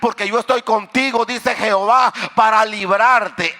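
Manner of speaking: loud, impassioned preacher over music; full-voiced sermon climax; fervent preaching.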